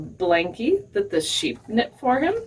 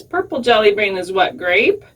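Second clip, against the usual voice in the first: ordinary delivery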